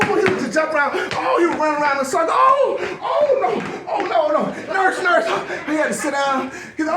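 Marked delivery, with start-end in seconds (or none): 2.3-5.2 high, loud, horrified cries; mock panic. Panicking doctor calling the nurse, hammed-up horror. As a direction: call for help in hammed-up alarm.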